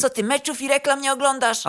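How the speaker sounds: high-pitched incredulous scolding; pissed-off manager. A narrator's reading. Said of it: "Kierownik był równie wkurwiony, co inni". (wkurzone pytanie wysokim głosem)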